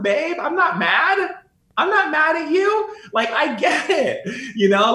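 chuckling while comforting a partner born into royalty; laughing reassurance. Retold or imitated. Reassuring girlfriend, not mad.

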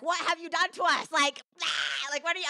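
high-pitched imitated yell; yelling womb. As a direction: act out a furious, high-pitched telling-off. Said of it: "my womb was yelling at me".

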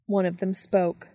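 usual speaking voice

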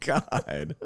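ordinary delivery

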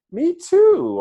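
a chirpy, overeager agreement; eager squeaky me too. Retold or imitated. Excited stranger matching answers.

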